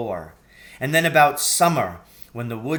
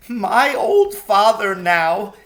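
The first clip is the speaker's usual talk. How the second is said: weeping faun; 8 semitones higher, 8 dB louder, slower than usual. A narrator's reading. Tumnus weeping.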